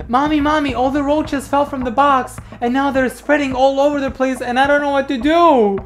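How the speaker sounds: put-on high panicked child; squeaky child calling mommy; little kid voice. Child voice in skit.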